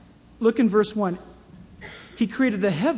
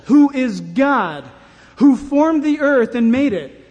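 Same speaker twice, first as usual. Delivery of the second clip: preaching scripture; 3 semitones higher, 7 dB louder than usual. Acted. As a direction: thunder out the verses of Job. Preacher reads Isaiah aloud.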